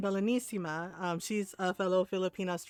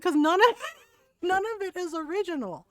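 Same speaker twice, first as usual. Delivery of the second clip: laughing through the words, higher; 7 semitones higher, 4 dB louder than usual. Retold or imitated. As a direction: giggle while you say it. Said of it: "I was like".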